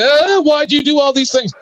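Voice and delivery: put-on cry of protest; mock crying. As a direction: imitate someone wailing in complaint. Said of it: "crying on the internet saying".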